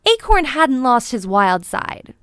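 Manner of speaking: ordinary delivery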